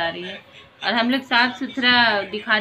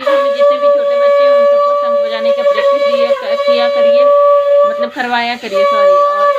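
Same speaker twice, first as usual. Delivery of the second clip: much higher, louder and fast; loud fast instruction; 12 dB louder, faster than usual. Real, not acted. Mother urging parents loudly and fast, higher pitch. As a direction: rush the advice out loudly.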